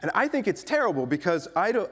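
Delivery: ordinary talk, nothing marked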